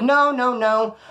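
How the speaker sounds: high, loud imitated panic; fearful refusal. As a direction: blurt it out like a scared man backing off. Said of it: "I saw the fear".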